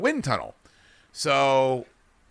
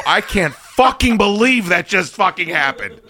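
higher, louder retold disbelief; exasperated outburst; 7 semitones higher, 5 dB louder than usual. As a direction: retell a fed-up, stunned outburst. Acted reaction to dropped pizza.

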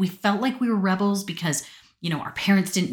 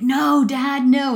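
put-on higher, louder teen protest; whiny protest; 10 semitones higher, 8 dB louder, much slower than usual. Teen daughter protesting to her dad on the phone, loud whine. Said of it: "I was like".

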